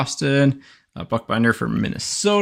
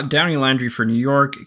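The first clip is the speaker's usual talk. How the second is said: ordinary delivery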